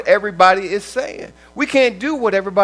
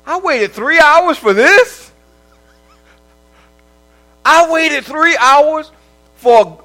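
indignant complaint; 7 semitones higher, 6 dB louder than usual. Retold or imitated. Patient griping to the doctor after three hours, indignant and loud. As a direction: stretch each word like you can't believe it.

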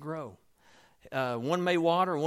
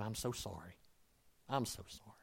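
hushed, sheepish apology, almost under the breath; whisper-soft sorry passed down the row; apologetic murmur; 4 semitones lower, 10 dB quieter than usual. Retold or imitated. Disciple passing a crumb down the row, softly sorry.

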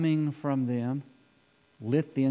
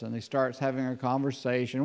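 normal talking voice

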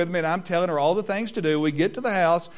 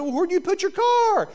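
mock innocent dad; 14 semitones higher than usual. Father mock innocence in story.

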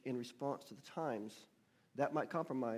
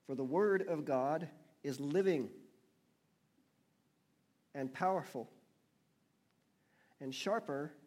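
emphatic scripture reading; 4 dB louder than usual. Real, not acted. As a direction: read it out phrase by phrase, leaning on key words. Reads scripture aloud to congregation.

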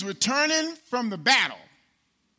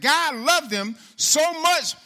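slow, higher and louder preaching; preaching emphasis; 5 semitones higher, 5 dB louder, slower than usual. Acted. Preacher stresses God's love to the congregation. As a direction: lean into it like a preacher.

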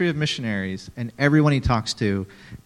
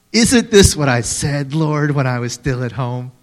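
hammed-up petulant Jonah; whiny angry Jonah; 2 semitones higher, 6 dB louder than usual. Shown as a demonstration. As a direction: read it like a sulking prophet. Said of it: "You have to read it like that".

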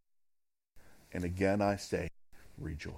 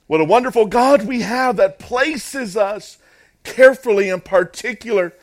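high, very loud, relentless sermon delivery; full-force preaching; 14 semitones higher, 15 dB louder than usual. Real, not acted. Preacher telling believers God placed them, impassioned. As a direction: thunder each point to the congregation.

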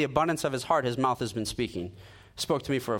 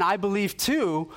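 slow, higher, emphatic insistence on too; a friend insisting he believes too; imitated earnest claim; 6 semitones higher than usual. Friend repeats claim, first take.

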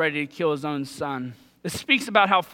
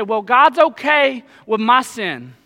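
drawn-out, smug, louder mock; slow mock complacency; 5 semitones higher, 7 dB louder, slower than usual. Preacher mocks a sinner excusing himself, slow and smug. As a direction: stretch it out like a self-satisfied sinner.

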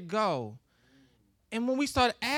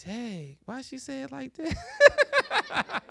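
mock shocked onlooker; much slower than usual. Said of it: "and they're like".

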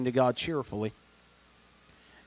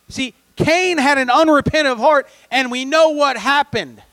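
loud, high, driving pulpit delivery; full-force preaching; 11 semitones higher, 8 dB louder than usual. Real, not acted. Preacher challenging the congregation about false repentance, full-force preaching. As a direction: drive each point home at raised pitch.

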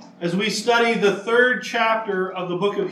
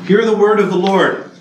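formal reading; 7 dB louder than usual. Acted. Formal scripture announcement.

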